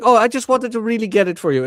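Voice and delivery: high, loud mock pleading; imitated pleading girlfriend. Girlfriend explaining her ice cream trip, mock pleading.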